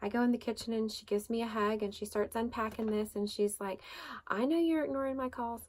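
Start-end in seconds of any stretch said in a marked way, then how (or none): 4.3-5.6 lightly imitated Southern friend, pitched up; a higher, knowing Southern lilt; Rebecca's Southern accent. Friend confronting her about ignored calls, light Southern accent. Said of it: "she has this funny little Southern accent".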